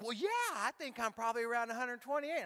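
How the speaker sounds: high, quick imitation of a flattered woman. Woman fibbing about her weight, flattered.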